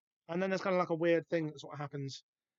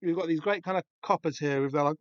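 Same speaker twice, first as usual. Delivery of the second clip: ordinary talk, nothing marked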